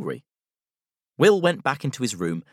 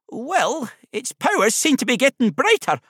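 high, loud Scottish teddy bear; high-pitched Scottish character voice; Scottish accent; 14 semitones higher, 5 dB louder than usual. A narrator's reading. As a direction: agree brightly in a Scottish accent. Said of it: "a voice with a soft Scottish accent".

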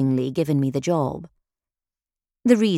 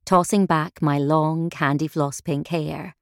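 ordinary delivery, nothing marked

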